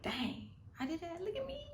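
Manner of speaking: high, delighted little brag; proud self-praise. Retold or imitated. Praising yourself instead of impressing others, delighted. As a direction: sound thrilled with yourself. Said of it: "How about you say".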